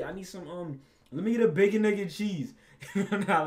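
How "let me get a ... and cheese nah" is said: loud, breaking into laughter; can't hold the laugh in; cracking up while ordering breakfast. Real, not acted.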